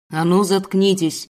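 loud, slow threatening command; menacing yell. Acted. Stranger to growling wolf cubs, menacing yell. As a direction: order them silent, loudly and threateningly. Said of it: "В ответ послышался грозный вопль".

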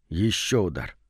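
ordinary delivery

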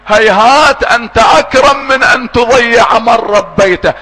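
slow, low, devout reciting of the prayer; supplication recitation. Acted. Reciting Dua Kumayl. (تلاوة دعاء بخشوع وببطء)

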